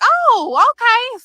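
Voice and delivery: high, sarcastic oh okay; mock surprised okay. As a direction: play it as fake innocent surprise. Mock surprised reply to the guard.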